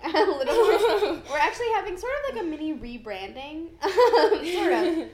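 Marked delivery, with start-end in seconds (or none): 1.3-5.1 giggling about the rebrand; laughing. Real, not acted. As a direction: laugh the words out.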